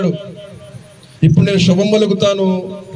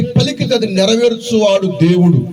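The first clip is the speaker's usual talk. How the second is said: raised, slow preaching cadence with drawn-out phrases; preaching at full force; 4 semitones higher, slower than usual. Acted. Preacher to his congregation, lifted preaching voice.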